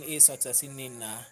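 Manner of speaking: ordinary delivery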